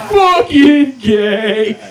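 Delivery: loud, high, drawn-out jeer; mock heckling shout. Comedian heckling a jersey wearer in Applebee's, mock shout. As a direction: yell it slowly across a restaurant. Acted heckle at imagined restaurant patron.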